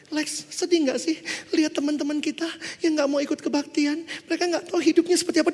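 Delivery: high, crying-style voice; acted weepy, high-pitched appeal; imitated tearful plea. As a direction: imitate a senior pleading through tears. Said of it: "Tiba-tiba dia nangis".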